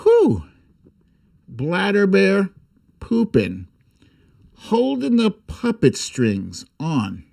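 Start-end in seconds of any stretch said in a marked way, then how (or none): none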